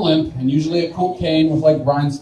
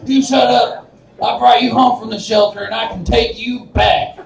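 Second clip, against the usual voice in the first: high-pitched mock scolding of a dog; 7 semitones higher than usual. Owner threatens dog with the shelter, mock scolding. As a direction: play an owner telling off the dog.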